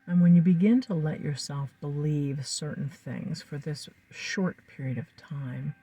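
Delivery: ordinary talk, nothing marked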